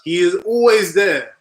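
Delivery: emphatic. Real, not acted. Praising defender.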